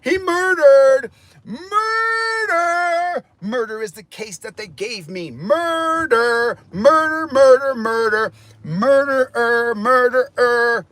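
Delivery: high, drawn-out chanting cries of murder; wailing accusation. Real, not acted. Crying out that the cop murdered him, wailing accusation. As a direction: hold each word long and high, half sung. Wails murder, quoting a rap lyric.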